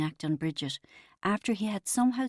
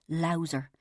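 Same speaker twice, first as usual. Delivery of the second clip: plain reading voice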